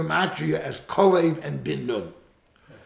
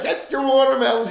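loud high vendor cry; calling with full force; 10 semitones higher, 9 dB louder than usual. Retold or imitated. Seller earning bread, loud full-force hawking. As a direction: belt it out like a seller. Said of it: "with all of his kayach".

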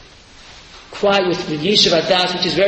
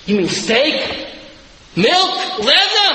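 climbing much higher with each word; each guess more amazed than the last; child's growing amazement; 8 semitones higher, slower than usual. Acted. Child imagining riches.

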